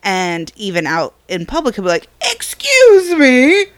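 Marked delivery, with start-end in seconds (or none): 2.3-3.7 grand announcement. Retold or imitated. Son announcing his fart to the public, high and slow. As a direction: excuse me sung out high, slow and loud. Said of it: "announcing it to the world".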